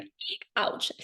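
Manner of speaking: whispered high eek; mock wince. Reader wincing at the Ten of Swords. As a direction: flinch at a painful card, whispered. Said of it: "where I'd be like".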